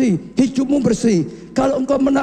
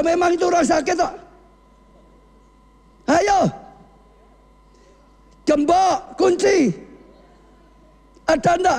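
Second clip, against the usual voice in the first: high, driving exhortation to the congregation; fiery preaching; 8 semitones higher than usual. Acted. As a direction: urge them hard to lock their hearts. (khotbah berapi-api dengan nada tinggi)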